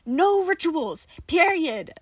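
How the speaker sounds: loud, slow, emphatic yell; frustrated shout. Acted. Johnny shouts his refusal at Nicola. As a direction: lay down the law. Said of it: "Johnny shouted back".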